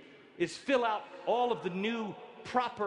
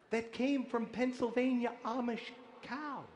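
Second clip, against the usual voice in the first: ordinary talk, nothing marked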